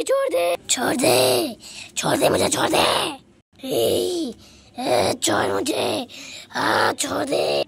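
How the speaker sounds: deep witch voice begging release; witch pleading. Acted. Trapped witch begging the girl, deep growl. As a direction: growl to be let go.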